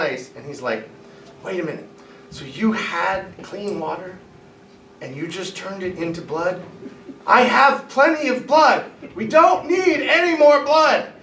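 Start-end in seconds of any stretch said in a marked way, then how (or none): 1.4-6.6 hushed aside. Acted. Pharaoh leaning over to his magician, hushed. Leans over to John Ace. 7.3-11.1 high, loud, slow exasperation; exasperated outburst. Acted. Pharaoh scolding his magicians, exasperated high outburst.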